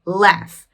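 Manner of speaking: "laugh" with a clear F at the end. Shown as a demonstration. Teacher confirming the rule on the word, "laugh" pronounced with final F. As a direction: say "laugh" as LAF for learners. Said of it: "tem o som de F".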